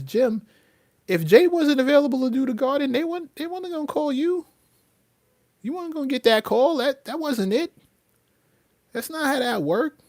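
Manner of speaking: high-pitched, exasperated reality check; incredulous retort. Retold or imitated. Telling Jim the call wasn't his, incredulous.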